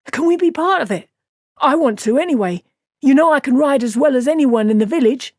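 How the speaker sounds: eager youth begging his elders; eager young Matt. Acted.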